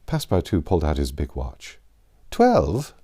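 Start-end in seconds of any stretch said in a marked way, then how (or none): 2.3-2.9 a sharp rising cry of disbelief; a startled exclaim, pitched up; exclaiming in surprise. A narrator's reading. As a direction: catch the time and blurt it out, startled. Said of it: "he exclaimed".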